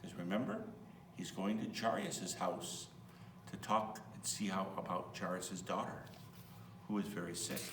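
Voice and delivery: plain reading voice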